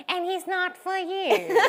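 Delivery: high, slow, drawn-out sing-song; sing-song aunt. Retold or imitated. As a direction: draw it out like a knowing aunt. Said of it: "and she'd go".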